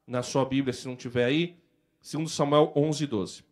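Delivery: usual speaking voice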